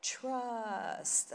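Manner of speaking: a drawn-out, airy meditation-app "Trust"; hammed-up meditation-app voice. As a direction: intone it like a guided meditation. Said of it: "the meditation voice is going".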